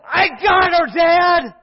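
loud, high cry of victory; triumphant shout. Retold or imitated. Boy shouting to his dad from the fire, triumphant yell. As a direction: shout it out, you made it. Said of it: "finally Eli said".

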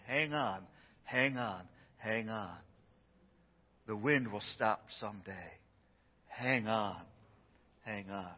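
soft, low and soothing; reassuring. Pretend. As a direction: gently coax them to hold on. Comforting someone in a storm.

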